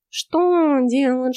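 snorting. Acted. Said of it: "фыркнул кот".

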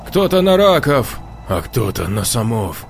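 ordinary delivery

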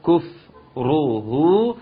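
كفره slowly with rounded lips on each damma; three dammas. Shown as a demonstration. Teacher showing the three dammas, slow. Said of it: "ثلاث ضمات".

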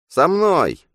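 very high, loud interjection; high-pitched sidekick shout; butting-in double. A narrator's reading. Double butts into exchange.

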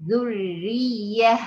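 ذرية drawn out very slowly, the light ra held; slow stretched ذرية; tajweed demo word. Shown as a demonstration. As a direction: model ذرية slowly with a thin ra. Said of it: "زي كلمة ذرية".

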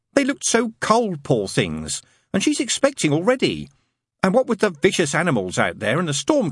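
a fussy angel stating a rule sincerely; prim angel. Acted. Angel telling the demon he can't do good, earnestly prim.

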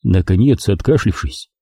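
plain reading voice

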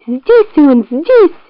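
high, urgent fox cry; high-voiced fox alert. A narrator's reading. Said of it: "кричит лиса".

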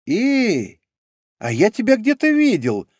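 high, bright little creature voice. Acted. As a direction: chirp it out like a small critter. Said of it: "присвистнул вдруг обжорка".

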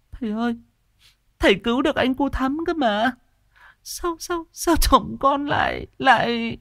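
high, sobbing plea; bursting into tears. A narrator's reading. Wife begging the healer through tears, high sobbing. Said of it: "Chị Nở òa khóc".

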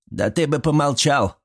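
put-on mildly barked rebuke from an old woman; snapping. A narrator's reading. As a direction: snap at him to shut up. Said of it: "рявкнула баба Стёша".

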